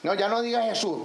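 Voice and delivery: ordinary talk, nothing marked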